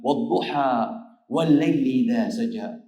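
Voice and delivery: slow, melodic Quranic chanting; Quran recitation. Acted. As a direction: recite it slowly, like tajweed. Recites Surah Duha in sermon. (mabagal at malambing na pagbigkas ng Quran)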